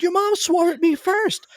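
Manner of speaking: high, slow indignant reply; high defensive. Retold or imitated. The companion defends herself to the son, high and indignant. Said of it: "She's like".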